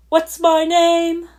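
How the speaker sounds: yelled. Acted. Character shouting a demand, slow yell. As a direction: bellow it out slowly. Sudden outburst in story.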